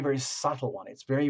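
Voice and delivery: normal talking voice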